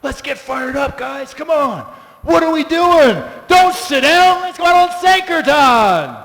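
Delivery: building to a loud, high rally; inspired devotee rousing friends to chant, building excitement; rallying cry. Retold or imitated. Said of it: "you're like".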